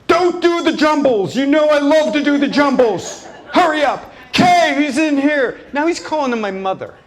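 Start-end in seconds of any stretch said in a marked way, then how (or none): 0.1-5.6 high, loud complaining shout; mock angry father; dad shouting. Dad demanding the jumbles, a loud high mock shout. Dad nagging his son.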